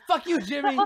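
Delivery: high, loud shout at her son; mom yelling back. Acted. Mom screaming back at Jimmy, very high and loud. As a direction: yell right back at him.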